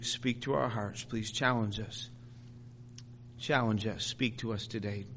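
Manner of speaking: low, soft praying voice; prayer. Real, not acted. Preacher leads congregation in asking to be challenged, prayerful.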